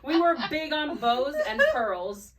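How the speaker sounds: high, loud confession through laughter; laughter spilling into the words; laughing. Real, not acted. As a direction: admit it while cracking up. Cracking up admitting bows and pearls.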